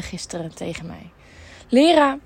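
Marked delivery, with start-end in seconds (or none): 1.7-2.2 called out loud and high; calling out. Retold or imitated. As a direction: call her name across the room. Parents calling her name.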